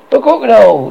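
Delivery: sudden loud high cry of fear; frightened gasp. A narrator's reading. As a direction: gasp out in terror. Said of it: "he gasped".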